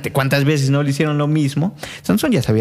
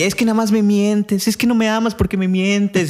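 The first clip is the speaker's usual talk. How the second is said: Delilah accusing; 7 semitones higher than usual. Retold or imitated. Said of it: "todo el tiempo peleando".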